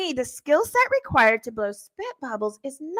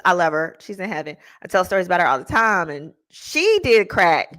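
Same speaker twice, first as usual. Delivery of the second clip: usual speaking voice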